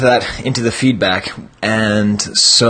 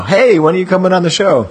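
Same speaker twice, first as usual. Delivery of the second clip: higher-pitched, hammed-up call-out; mock eager invitation; 7 semitones higher than usual. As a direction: imitate a chirpy caller breaking in. Imitating his own interrupting phone call.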